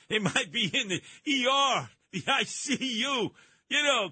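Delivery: normal talking voice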